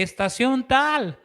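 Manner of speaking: high, slow call of a train conductor. Retold or imitated. Ticket collector calls the station to passengers, slow high call. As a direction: stretch it out like announcing a stop.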